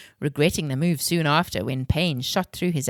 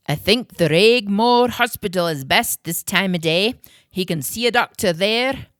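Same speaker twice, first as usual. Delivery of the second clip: Uncle Alistair; 4 semitones higher, 5 dB louder than usual. A narrator's reading. Uncle Alistair voiced, story figure.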